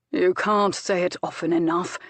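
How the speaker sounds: icy, ironic remark; cold irony. A narrator's reading. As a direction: let the irony chill each word. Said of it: "the Duke said with a cold, cold irony".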